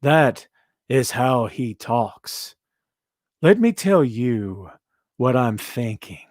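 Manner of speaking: very slow, measured delivery with pauses; Keith Lee's cadence. Retold or imitated. Showing viewers how he talks, slow halting cadence. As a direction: stretch each phrase out, deliberately halting. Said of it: "It's just his cadence".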